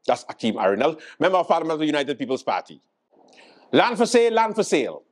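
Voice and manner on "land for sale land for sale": high-pitched hawker's call; mock sales pitch sung out high; [name]. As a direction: call it out high, repeating like a vendor. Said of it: "[name]".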